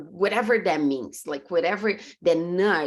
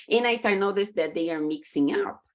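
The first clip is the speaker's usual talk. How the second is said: normal talking voice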